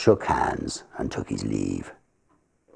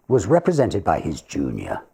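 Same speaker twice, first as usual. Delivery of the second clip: usual speaking voice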